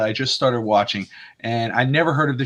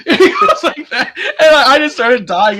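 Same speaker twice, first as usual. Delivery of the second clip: loud, high talk through real laughter; laughing too hard to tell it; cracking up; 9 semitones higher, 9 dB louder than usual. Real, not acted. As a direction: lose it while retelling. Teller cracks up over the scene.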